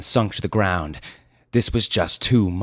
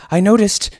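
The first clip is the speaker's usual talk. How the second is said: high, loud and breaking off; hesitant; 11 dB louder than usual. Acted. Nervous youth starting his report, hesitant. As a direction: start boldly, then falter. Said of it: "Pipkin hesitated".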